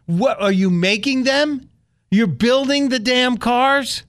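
mock outrage at the clerk; incredulous retort. Customer scoffing at the rental clerk, incredulous.

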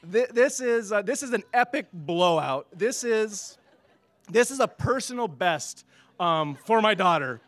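laughing. Real, not acted. Laughing about the blowout.

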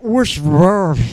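loud, garbled British cartoon voice; Nigel Thornberry. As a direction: bluster through mangled words like a cartoon Brit. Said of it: "British Thornberry accent".

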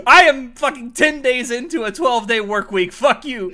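loud, high, laughing rant; laughing shout. Real, not acted. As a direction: shout it while cracking up.

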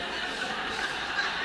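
silent wheezing laugh; breathy laugh. Real, not acted. Guest laughing at his own dumb story.

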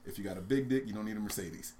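usual speaking voice

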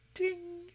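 high sung ding like a glint of light; a bright, high chime; ding sound. Acted. Sound effect in read story.

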